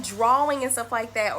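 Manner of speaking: ordinary delivery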